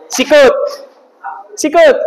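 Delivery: high, loud call; game command. Real, not acted. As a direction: shout it to the whole class.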